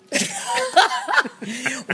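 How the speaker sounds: high burst of laughter; loud laugh. Real, not acted. Host laughing at aggression lessons idea.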